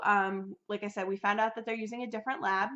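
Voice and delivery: ordinary talk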